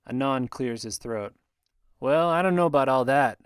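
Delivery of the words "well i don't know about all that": gruff interjection. Acted. Anon clears throat, breaks tension.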